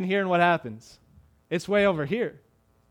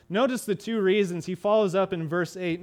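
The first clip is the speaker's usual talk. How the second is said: usual speaking voice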